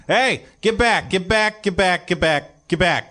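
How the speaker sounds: high-pitched, urgent repeated yell; guard yelling. Acted. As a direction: yell it like a guard warding someone off. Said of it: "start yelling".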